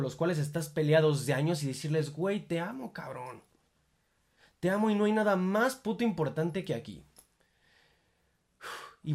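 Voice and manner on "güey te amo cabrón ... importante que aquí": emotional outburst. Pretend. Imagined heartfelt outburst to parents.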